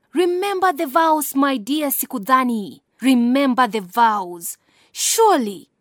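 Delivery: loud desperate pleading cry; hysterical cry. Acted. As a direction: cry it out, begging him. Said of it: "cried out hysterically".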